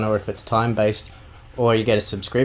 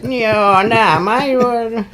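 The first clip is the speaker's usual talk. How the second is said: high, loud mock ocker bartender; 12 semitones higher, 6 dB louder, slower than usual. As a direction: ham up a defensive Aussie barman. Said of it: "the bartender goes".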